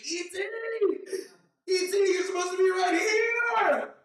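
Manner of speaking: high, slow, desperate yell into the night; screaming for E T. Acted. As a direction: scream his name up at the sky. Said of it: "he's screaming".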